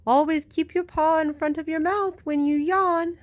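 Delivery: a high hooting owl giving advice. A narrator's reading. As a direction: scold like a fussy old owl. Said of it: "hooted the owl".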